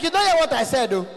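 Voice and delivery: high-pitched shouted complaint; poor man. Retold or imitated. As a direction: scold loudly down the phone. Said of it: "voice is always very high".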